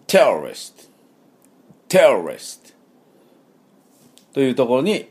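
テロリスト stressed on テ, slow; English terrorist. Shown as a demonstration. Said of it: "このテロリストの音はですね".

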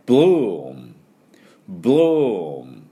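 long oo closing into a hummed m; bloom with m. Shown as a demonstration. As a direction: model bloom slowly, lips shut at the end. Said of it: "これはMの音です".

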